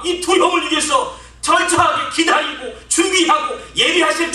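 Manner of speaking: builds from calm to loud, high preaching shout; fervent revival preaching, loud and high; rousing preaching. Acted. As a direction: preach it, rising to a full-voiced climax. Preacher's opening call to faith.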